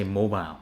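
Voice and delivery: British mobile as 'mo-bile'; British pronunciation. Shown as a demonstration. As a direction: end mobile like aisle, UK style. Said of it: "if you're from the U K".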